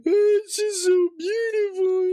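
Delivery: hammed-up weeping over beauty; mock sobbing.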